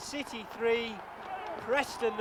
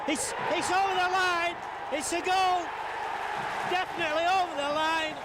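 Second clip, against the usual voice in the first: excited goal call; 4 semitones higher than usual. Real, not acted. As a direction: shout to confirm the goal. Commentator calls a goal.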